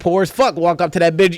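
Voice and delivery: ordinary delivery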